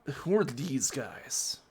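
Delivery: ordinary talk, nothing marked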